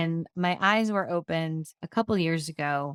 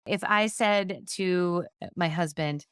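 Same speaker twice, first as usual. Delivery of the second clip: usual speaking voice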